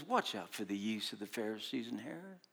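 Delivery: low, soft, hushed warning; a quiet, grave warning. Retold or imitated. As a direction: murmur it under the breath. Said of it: "Jesus says".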